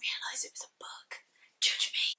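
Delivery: whispered, sheepish admission; whispered confession. Real, not acted. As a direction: own up under your breath. Confessing she only saw movie.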